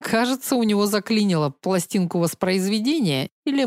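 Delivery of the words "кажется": ordinary delivery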